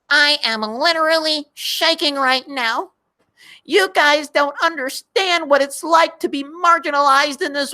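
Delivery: high squeaky mock voice; helium voice. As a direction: squeak it out like a whiny caricature. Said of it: "you like the voice, helium voice".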